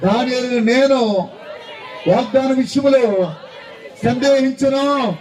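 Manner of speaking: high, loud and slow, every word stretched out; loud slow shout. Shown as a demonstration. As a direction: shout it out for the whole church. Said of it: "చెప్పండి బిగ్గరగా". (నెమ్మదిగా బిగ్గరగా అరుపు)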